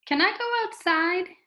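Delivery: "Can I go outside?" high, lifting on "outside"; asked as question. Shown as a demonstration. Teacher reading "Can I go outside?" for students, questioning. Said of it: "is a question".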